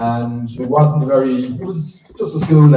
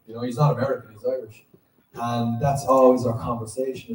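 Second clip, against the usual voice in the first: ordinary talk, nothing marked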